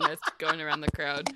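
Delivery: ordinary talk, nothing marked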